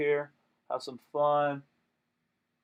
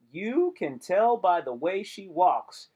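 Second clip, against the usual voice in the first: slow emphatic; 4 semitones higher, 5 dB louder, slower than usual. Acted.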